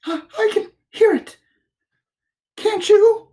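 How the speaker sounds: high, loud, stammering squeak; squeaky, jittery character voice; anxious Piglet. A narrator's reading. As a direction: ask it nervously as a little piglet. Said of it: "said Piglet anxiously".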